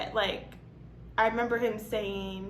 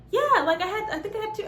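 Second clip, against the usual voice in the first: put-on airy, much higher voice of an oblivious young girl; high ditzy girl voice; 10 semitones higher, 4 dB louder, faster than usual. Young girl answering Allison's question, in a high ditzy voice.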